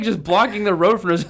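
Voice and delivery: ordinary talk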